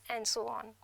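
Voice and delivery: usual speaking voice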